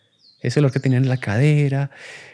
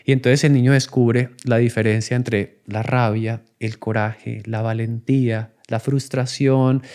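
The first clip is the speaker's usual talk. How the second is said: ordinary talk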